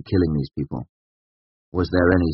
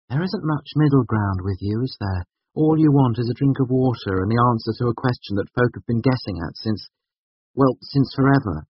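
higher voice, gently mocking his all-or-nothing; young woman; 8 semitones higher than usual. A narrator's reading. Woman ribs her friend about wanting answers, lightly voiced.

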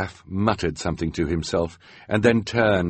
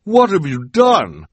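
high, louder alarmed question; Bruce demanding; 10 semitones higher, 8 dB louder than usual. A narrator's reading. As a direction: call out in dismay.

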